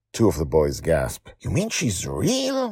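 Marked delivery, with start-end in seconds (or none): 1.4-2.7 high, slow, awestruck question; in awe. Acted. Party boy asking Charlie about Joy, awestruck. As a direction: ask it like you can hardly believe it. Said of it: "one of them says in awe".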